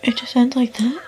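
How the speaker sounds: stunned whisper; hushed, dazed after the ending. Real, not acted. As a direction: whisper in disbelief. Whispering after finishing the book.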